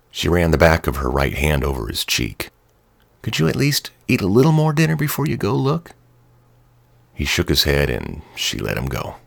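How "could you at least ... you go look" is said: much higher, gentle pleading wife. A narrator's reading. Wife begging husband to eat first, higher and pleading. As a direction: coax him to eat a bit more. Narrator voices Jess.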